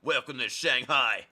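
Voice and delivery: loud slow drill sergeant; military man voice. Acted. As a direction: bellow it like a marine. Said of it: "my military man voice".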